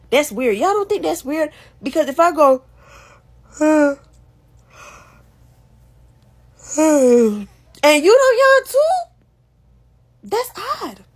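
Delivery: hammed-up shock that they didn't yawn; incredulous. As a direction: play up the disbelief, big and high. Acting out a yawn standoff.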